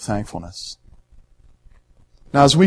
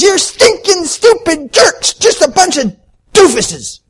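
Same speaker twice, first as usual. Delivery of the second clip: mock tantrum, much higher and louder, slow and drawn out; hammed-up rage at "imbeciles", pitched way up; mocking angry outburst; 7 dB louder, slower than usual. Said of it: "all of us mocked him".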